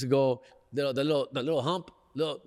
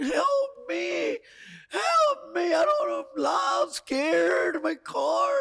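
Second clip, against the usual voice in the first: shrieking falsetto mock sobs; hammed-up crying panic; 4 dB louder, much slower than usual. Stranded driver calling everybody for help, exaggerated tearful panic. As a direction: wail for rescue in a very high, loud, babyish cry. Acting out panicked calls to friends.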